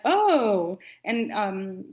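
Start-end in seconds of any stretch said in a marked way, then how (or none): 0.0-0.8 louder oh as it clicks; group realization. Retold or imitated. All the friends understanding the Southern word, realizing. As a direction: voice everyone getting it at once. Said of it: "all of us are like".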